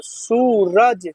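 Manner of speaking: Suraj drawn out very slowly; slow sounding out. Shown as a demonstration. Teacher showing pupils how to read Suraj, very slowly. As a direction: stretch Suraj out, long ऊ held. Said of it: "दीर्घ का र ज".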